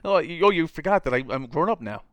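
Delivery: put-on high, loud chirpy teen; mock teen chirp. Son teasing his aunt, high mock-breezy. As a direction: toss it off like a carefree kid. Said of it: "It's like".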